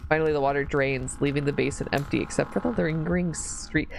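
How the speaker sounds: ordinary delivery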